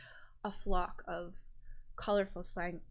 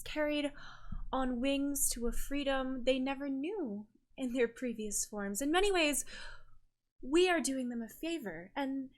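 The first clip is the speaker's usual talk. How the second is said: higher, lilting, serenely persuasive priestess; melodic island sister; 5 semitones higher than usual. Acted. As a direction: justify it sweetly, with pauses between phrases. Giggles melodically and she goes.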